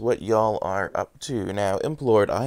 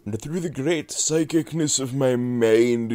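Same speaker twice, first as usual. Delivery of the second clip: mystic psychic voice; 5 semitones higher than usual. Pretend. Grand psychic pronouncement.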